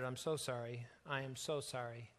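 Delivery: low, soft apology; muttered. A narrator's reading. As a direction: murmur it low in shared grief.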